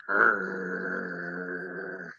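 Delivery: long, low rumbling "arr"; creature growl. Retold or imitated. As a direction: imitate a little monster growling, softly. Imitates the creature.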